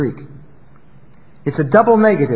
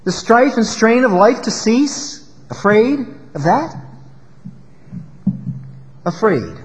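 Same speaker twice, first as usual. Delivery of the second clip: poem recitation; 4 semitones higher than usual. Acted. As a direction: recite it gently, each question hanging.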